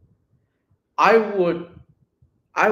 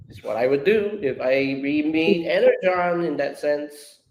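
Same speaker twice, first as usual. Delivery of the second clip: ordinary talk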